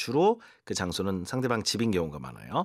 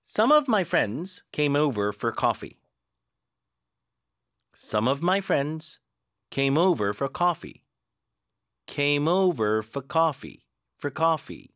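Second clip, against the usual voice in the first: very slow, words linked; linked read-along; 3 semitones higher, much slower than usual. Shown as a demonstration. Teacher linking the coffee sentence for students. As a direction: chain the words smoothly. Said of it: "이렇게 연결할 수도".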